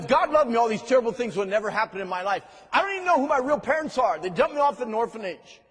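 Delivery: loud, high, fast retold protest; hammed-up cry of a hurt teen; preacher voicing a bitter girl's loud outburst; imitated angry outcry.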